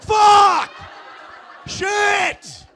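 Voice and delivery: high, loud, drawn-out howls of defeat; anguished cursing. Retold or imitated. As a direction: scream each curse as its own outburst. Reacting to rival's sexy name.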